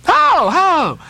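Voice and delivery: high, loud, eager cry. Acted. Young Spaniards crowding the teller, crying out high and eager. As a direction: beg for the story. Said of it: "cried all the young Spaniards crowding".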